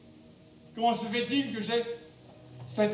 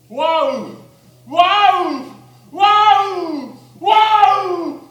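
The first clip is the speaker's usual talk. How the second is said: building awed cries; 2 semitones higher, 14 dB louder, much slower than usual. Acted. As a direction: cry out in growing wonder. Prior awed by the angel.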